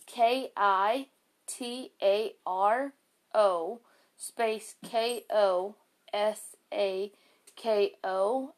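slow, letter by letter spelling. Shown as a demonstration. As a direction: spell each letter out slowly and clearly. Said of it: "I'm gonna spell it out for you guys".

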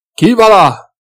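high, loud wary call through the door; suspicious shout. A narrator's reading. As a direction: challenge whoever knocks, loud and wary. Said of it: "gridò Lothar Krippmann in tono diffidente". (Krippmann grida diffidente da dietro la porta)

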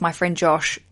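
usual speaking voice

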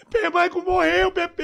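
mock wail over Michael's death; imitating crying friend. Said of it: "Ele chorando".

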